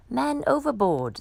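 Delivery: called out over the water; sailors crying out. Acted. Sailors shouting for help. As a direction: raise the alarm. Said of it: "the sailors cried".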